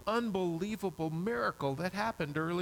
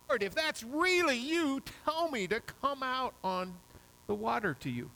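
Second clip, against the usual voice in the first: much higher, urgent, daring plea; eager plea to Jesus; 7 semitones higher than usual. Retold or imitated. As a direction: beg to be called out onto the water. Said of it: "and you say".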